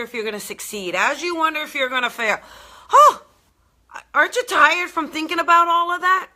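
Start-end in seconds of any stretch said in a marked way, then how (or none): 2.9-6.3 exaggerated oh. Real, not acted. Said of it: "teasing sympathy".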